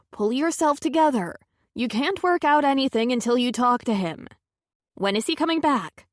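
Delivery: steady her with firmness; firm friend voice. A narrator's reading. Carlotta firm comfort.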